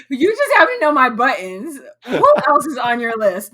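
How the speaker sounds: loud laughing through the words. Real, not acted. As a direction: laugh helplessly while talking. Laughing after teasing.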